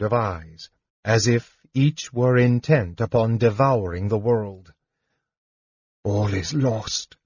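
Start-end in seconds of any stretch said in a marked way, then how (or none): 6.0-7.2 quiet, slow despair; understated despairing thought. A narrator's reading. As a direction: let the words sink, drawn out and hopeless. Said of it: "thought Tanis in despair".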